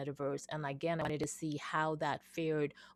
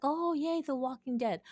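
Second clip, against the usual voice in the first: lightly acted cheer at a discovery, pitched way up; retold happy reaction, higher than usual talk; mock excitement; 9 semitones higher than usual. Retelling her first excited reaction.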